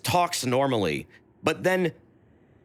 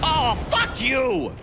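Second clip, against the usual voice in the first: frustrated shout; 10 semitones higher, much slower than usual. Real, not acted. Angry at game enemy.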